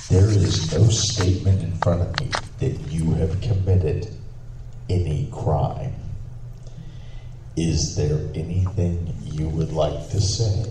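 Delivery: measured courtroom advice with weighty pauses; pointed judge. Retold or imitated. Judge advising lady, deliberate hinting.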